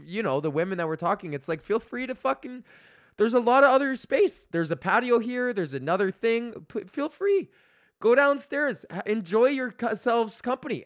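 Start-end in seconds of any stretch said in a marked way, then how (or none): 1.6-10.8 exasperated telling-off. Retold or imitated. Telling off chatty women.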